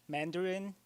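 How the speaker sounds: normal talking voice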